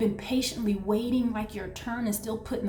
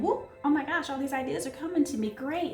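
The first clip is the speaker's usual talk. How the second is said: fast, high, giddy rush of ideas; excited rush; 5 semitones higher than usual. Retold or imitated. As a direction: blurt out the sudden ideas. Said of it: "We have the Fool who's just like".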